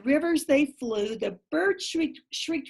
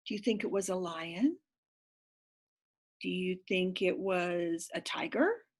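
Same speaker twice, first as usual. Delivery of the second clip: hushed; 7 semitones lower, 7 dB quieter than usual. Real, not acted. Quiet guessing to child.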